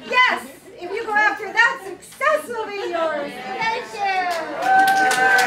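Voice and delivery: high, loud triumphant shout; rally the crowd to the finish; rousing finish. Real, not acted. Speaker firing up the audience at the end, shouting.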